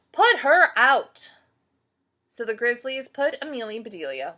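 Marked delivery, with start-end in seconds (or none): 0.1-1.3 loud, slow, high demand; angry shout. Acted. Team shouting to send her out, slow loud yell. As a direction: bellow it, fed up. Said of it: "they shouted".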